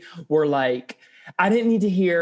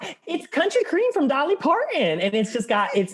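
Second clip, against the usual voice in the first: Southern twang; 8 semitones higher than usual. Pretend. Player pitching [name] country cream, Southern twang. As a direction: sell it in a playful Southern twang. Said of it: "We need that Southern twang".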